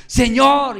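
loud, high cry to the Lord. Acted. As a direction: call out desperately to be heard. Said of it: "comenzó a gritar".

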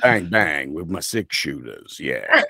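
low-ending cowboy drawl; American cowboy. Shown as a demonstration. Showing off his standard American, cowboy voice. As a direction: play a swaggering movie cowboy accent.

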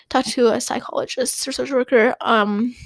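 ordinary talk, nothing marked

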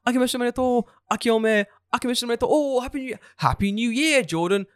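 high-pitched imitation of excited well-wishers; overlapping New Year well-wishes, hammed-up; mock cheery greeting. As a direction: pitch it way up, like friends greeting each other. Crowd's new year greetings retold.

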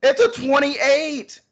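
high shriek of disbelief; shrieking jackpot winner. Real, not acted. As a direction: shriek out the number, stunned. Reacting to a bad score.

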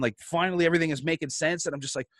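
ordinary talk, nothing marked

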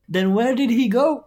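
high, loud demand; challenging question. Real, not acted. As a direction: fire the question back, loud and high-pitched. Acted insistent challenge in debate.

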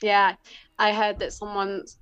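ordinary talk

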